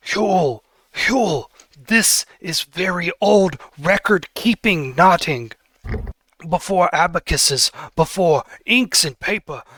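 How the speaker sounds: light up over the blue quartz; excited Billis voice. Acted. Billis lectures on knot records.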